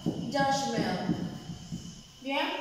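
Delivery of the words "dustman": "dustman" said carefully as an English word; English word demo. Shown as a demonstration. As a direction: model "dustman" for learners.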